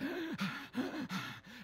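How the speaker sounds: heavy out-of-breath gasp, imitated; mock exhausted gasp. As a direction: gasp like you're about to collapse. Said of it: "I'm behind him".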